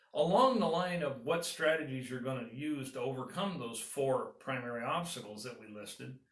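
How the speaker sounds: ordinary talk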